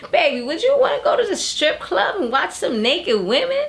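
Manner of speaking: lightly voiced old lady, pitched high; a hint of a sweet old lady asking her man. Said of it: "she got that old lady voice".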